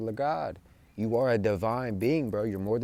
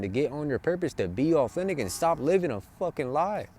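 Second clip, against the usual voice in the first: normal talking voice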